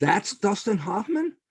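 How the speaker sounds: high, slow, stunned question; as if he can't believe it's the same actor; disbelieving surprise. Retold or imitated. Retelling his own shock at the movie, drawn-out high disbelief. Disbelief at not recognizing him.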